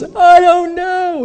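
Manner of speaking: high, loud, drawn-out mock wail; hammed-up tearful wail; crying imitation. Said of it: "He was crying".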